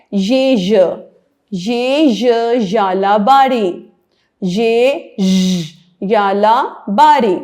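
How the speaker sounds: put-on خے خ said slowly and clearly for children; slow letter drill. Shown as a demonstration. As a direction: spell it out slowly, letter sound first. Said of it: "letter drill for children".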